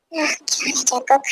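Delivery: breathy intimate whisper; soft whispered tease. Acted. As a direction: murmur it close to his ear. Intimate reply to partner.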